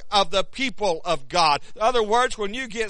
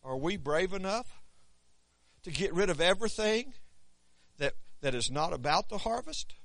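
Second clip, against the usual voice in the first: softer, reflective sermon questions put to the room; hushed searching questions; 10 dB quieter than usual. Acted. Preacher quietly asking the congregation if they are brave enough, hushed. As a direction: let it drop and ask quietly, almost to yourself.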